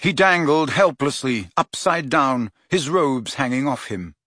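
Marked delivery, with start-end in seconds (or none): none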